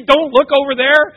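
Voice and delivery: loud, high, urgent yell; yelled warning. Acted. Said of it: "If somebody yells at you".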